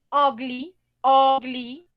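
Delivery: ugly said as "ogly", u like a Spanish o; ugly, u sounds like o. Shown as a demonstration. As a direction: open the u of ugly toward o. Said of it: "U se pronuncia como una O".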